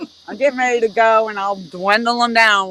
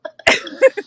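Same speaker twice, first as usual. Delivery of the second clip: squeaky laugh; 4 dB louder than usual. Real, not acted. Host cracking up at friend.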